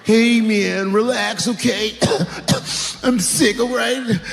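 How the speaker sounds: high, raspy junkie voice, pleading; strung-out woman. Retold or imitated. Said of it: "She said".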